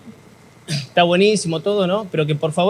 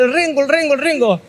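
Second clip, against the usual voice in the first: high-pitched alarmed calling; mock panicked crowd shout; 7 semitones higher than usual. Joke crowd spots the lame man, high mock shout. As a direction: yell it like a frightened crowd pointing. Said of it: "empieza a gritar".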